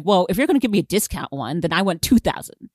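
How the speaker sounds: ordinary delivery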